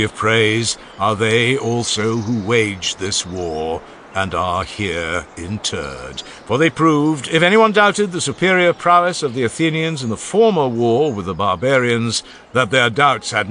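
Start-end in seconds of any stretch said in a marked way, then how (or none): none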